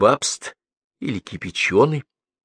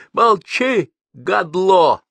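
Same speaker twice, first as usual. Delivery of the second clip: high, loud angry bark; barked order; 9 semitones higher, 6 dB louder, much slower than usual. A narrator's reading. Man grabbing another by the shirt, barking. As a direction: bark it in his face. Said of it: "гаркнул он".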